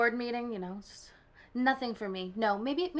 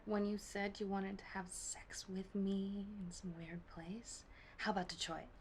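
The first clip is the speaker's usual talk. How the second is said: seductive whisper; 13 dB quieter than usual. Acted. Caller in a meeting recalls a private wish, seductive whisper. Acted seductive phone call in audition.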